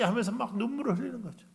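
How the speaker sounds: ordinary talk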